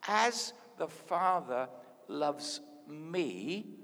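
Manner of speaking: very slow scripture reading, word by word; drawn-out, deliberate quotation; really slow. Shown as a demonstration. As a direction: leave space around each word. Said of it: "say it to you really slowly".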